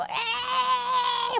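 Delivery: long, high mock bawl; acted drawn-out cry while hanging on; clinging wail. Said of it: "예수님을 붙잡고".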